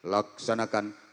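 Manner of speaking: low, soft, slow command; how not to answer at a ceremony; wrong soft reply. Shown as a demonstration. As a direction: say it limply. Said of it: "nggak boleh gini".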